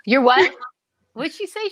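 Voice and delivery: ordinary talk, nothing marked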